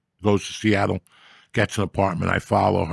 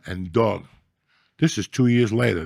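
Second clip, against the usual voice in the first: ordinary talk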